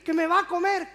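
high, loud mock wail; terrified child. Child warning dad of being eaten, loud mock terror. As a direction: cry out in hammed-up fear.

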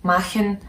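machen with the German ch fricative; German CH sound. Shown as a demonstration. Teacher giving an example word for CH, German CH in machen. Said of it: "it's a H sound".

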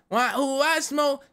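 usual speaking voice

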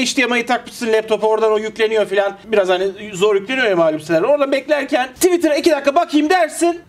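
ordinary talk, nothing marked

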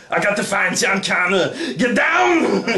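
gruff action-hero shout; Terminator voice. Retold or imitated. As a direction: bark it like a movie cyborg. Terminator quote after surgery.

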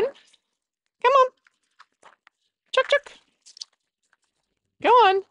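high coaxing chicken call. Real, not acted. Herding hen back in.

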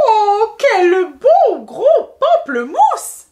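high, loud, drawn-out exclamation; admiring cry. Acted. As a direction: gush slowly over a wonderful find. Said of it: "s'écria Madame Henriette".

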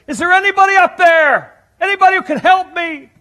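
high, loud panicked call up the cliff; a man hanging off a canyon wall, calling for help; desperate cry for help. Retold or imitated. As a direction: yell up desperately, voice cracking high. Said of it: "in his desperation, he says".

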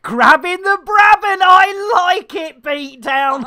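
high, loud, hyped-up cheer; loud, high-pitched whoop of approval; excited shout. Real, not acted. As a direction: belt it out with glee. Calls out excitedly after pet clip.

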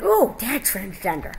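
slow, drawn-out mock "ooh"; mock reaction. Friend jokes about the changed character, mock surprise. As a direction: play up a slow reaction of discovery.

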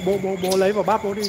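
ordinary talk, nothing marked

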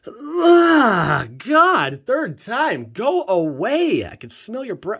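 high, loud, slow put-on voice; high squealing character. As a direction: squeal it like a disgusted cartoon girl. Squealing reaction to a card.

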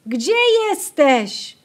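high, loud, slow worried call; lost in the corn, calling for help. A narrator's reading. Lost girl calling for sister.

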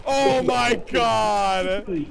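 long, slow cry of disbelief; drawn-out exclamation. Real, not acted. Player reacting to the stair flea, drawn-out exclamation.

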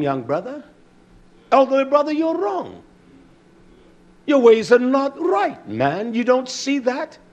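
high, scolding rebuke; lightly hammed-up church scolding; acted quarrel. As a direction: call out the brother to his face. Acted argument between brothers.

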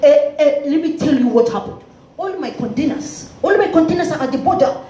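put-on heavy African accent building to high, outraged cries. African man protesting the border charge to a collector, African accent. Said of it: "My next call was to an African".

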